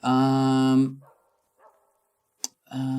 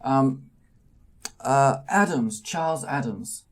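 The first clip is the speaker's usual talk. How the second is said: put-on small old man voice; 6 semitones higher, 6 dB louder than usual. GM voices Charles Adams.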